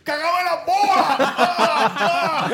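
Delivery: high, slow mock yell with laughter; mock shout. Imita grito de la esposa. (grito burlón entre risas)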